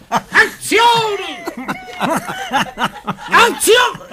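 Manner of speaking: loud, high Italian shout of Azione; Italian director. Retold or imitated. Italian director yelling at the American actor, loud Italian shout. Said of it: "And he says".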